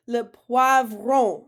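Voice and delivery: teacher introducing a new word slowly; slow model word. Shown as a demonstration. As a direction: stretch it out for learners. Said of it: "I'll say it, you say it".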